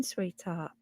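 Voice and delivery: a soft breathy sweetheart tailing off; whispered endearment. Real, not acted. Greeting viewer Bev in chat, whispered endearment. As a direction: breathe the word softly to her.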